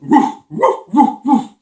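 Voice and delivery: short, loud imitated woofs; punchy run of dog barks; dog barking. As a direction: bark like a big dog. Narrator voices the dog barking.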